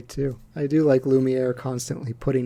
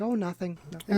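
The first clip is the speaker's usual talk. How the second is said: lightly voiced motherly teapot, a little higher; a hint of a gentle mum brushing off a question; imitating Missus Potts; 5 semitones higher, 5 dB quieter than usual. Fan lightly voices Missus Potts answering Chip. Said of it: "and she's just like".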